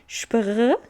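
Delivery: Spr with the R gargled from the back of the throat; Spr, shp plus throaty r; guttural r. Shown as a demonstration. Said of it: "vem lá do fundo da garganta".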